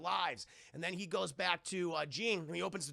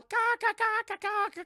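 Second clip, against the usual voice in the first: loud, very high gull squawks; seagull cawing; 9 dB louder than usual. Retold or imitated. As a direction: imitate jeering seagulls at full pitch. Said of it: "the seagulls are going".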